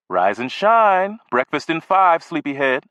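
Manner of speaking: high, slow sing-song morning call; mother's wake-up call. Acted. Mother calling through the door to wake her son, slow and sing-song. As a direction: coax a sleepy kid out of bed.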